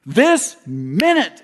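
loud, high, slow proclamation; dramatic preacher. Retold or imitated. Evangelist proclaiming the second coming, loud and slow. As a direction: thunder it like a revival preacher. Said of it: "Dramatic pause".

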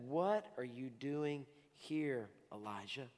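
slow, softly asked question; gentle voice of God. Acted. God asking Elijah at the cave, slow gentle question. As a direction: ask it tenderly, slowly. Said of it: "a voice came to him and said".

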